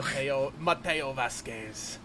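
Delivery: slow, rolled Spanish accent on the name; Mateo Spanish accent. Shown as a demonstration. Showing off his Mateo accent to a podcast co-host, slow Spanish accent. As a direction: say the name like a Spanish swordsman, slowly. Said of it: "I actually really like my Mateo accent".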